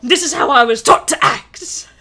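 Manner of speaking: put-on British accent, mock upset. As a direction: protest in hammed-up British tones. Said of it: "because I'm British now".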